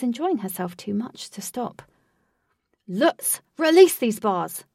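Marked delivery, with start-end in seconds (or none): 2.9-4.6 loud angry demand; furious, pinned down; ranting. Acted. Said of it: "ranted Wraith".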